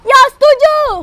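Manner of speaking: high, loud eager yes; eager agreement. Acted. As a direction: agree with fire.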